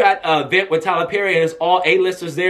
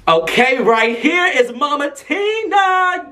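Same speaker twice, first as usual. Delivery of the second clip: high, drawn-out announcing shout; hyped introduction; 9 semitones higher, much slower than usual. Real, not acted. Host presents his celebrity escort video, hyped introduction. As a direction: present a star with rising, stretched-out excitement.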